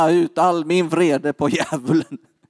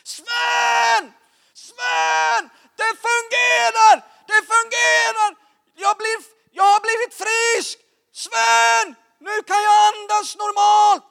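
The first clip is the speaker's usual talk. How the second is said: imitating a man's ecstatic scream; very high, strained, drawn-out yells; screaming at full throat; slower than usual. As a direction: scream it out like someone just healed. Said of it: "Han skrek för full hals".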